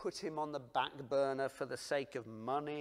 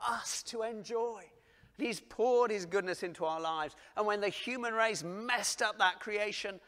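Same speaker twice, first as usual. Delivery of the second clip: loud, higher impassioned preaching; full-force preaching; 7 semitones higher, 8 dB louder than usual. Acted. Preacher to congregation on God's love, loud and impassioned. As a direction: preach it out to the room.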